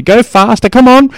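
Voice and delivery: high, loud urging shout; yelling kid. Retold or imitated. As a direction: drive him on like a coach. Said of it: "he's yelling at me".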